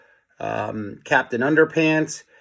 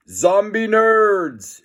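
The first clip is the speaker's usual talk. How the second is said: higher, slow commanding call; alien commanding; 8 semitones higher, 4 dB louder, much slower than usual. A narrator's reading. Alien calls her zombie nerd army, slow and commanding. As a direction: summon your troops, drawn out. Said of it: "instructed Jennifer".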